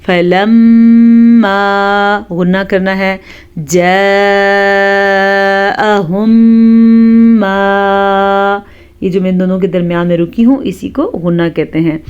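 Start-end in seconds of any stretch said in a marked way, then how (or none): 0.1-2.2 slow, loud chanted फलम्मा with nasal hum on the meem; tajweed recitation. Shown as a demonstration. Teacher showing students the ghunna. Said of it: "तो हम इसको पढ़ेंगे". 3.6-8.6 slow, drawn-out tajweed chant; Quran recitation. Shown as a demonstration. Teacher reciting for students.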